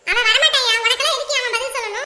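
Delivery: loud, high retort; angry mother; defiant shout. Acted. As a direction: refuse to hand over her son.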